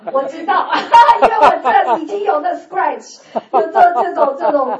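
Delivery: loud, laughing through the words. Real, not acted. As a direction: chuckle it out, amused. Laughing about scratched floor.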